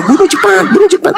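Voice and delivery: high, hurried devil aside; devil's secret urging. Acted. Preacher voicing the devil's real intent, urgent aside. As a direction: urge the executioner on in a high voice.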